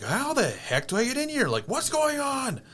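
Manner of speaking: hammed-up frustrated complaint; mock loud frustration. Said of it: "but loudly saying".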